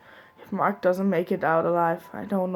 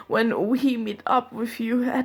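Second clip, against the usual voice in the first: fighting back tears; 4 semitones higher than usual. Real, not acted. Jack apologizing to Tom, voice breaking with crying.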